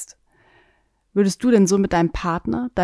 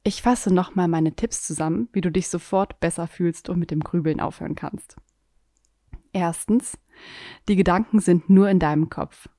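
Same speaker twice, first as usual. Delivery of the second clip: usual speaking voice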